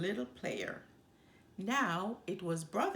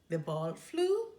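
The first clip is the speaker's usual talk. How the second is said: ordinary delivery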